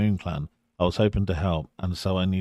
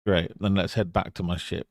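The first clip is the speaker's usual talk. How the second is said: normal talking voice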